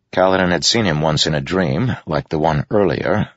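ordinary delivery, nothing marked

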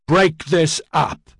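slow, high, loud roar. A narrator's reading. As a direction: bellow it at the mob. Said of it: "Edyard roared".

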